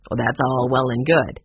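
low, growly man's voice; growly George. A narrator's reading. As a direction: growl it like a grumpy caretaker. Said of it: "George Gardner said in a growly voice".

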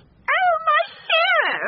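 very high, adoring squeal; swooning cow. A narrator's reading. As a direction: gush over your hero. Cow swoons over her bull.

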